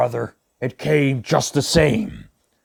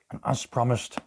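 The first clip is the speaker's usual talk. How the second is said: ordinary delivery, nothing marked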